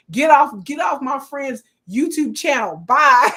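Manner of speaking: loud, emphatic send-off; retold angry dismissal. Chasing a troll off a friend's channel, loud dismissal. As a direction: shoo them off with a big final word.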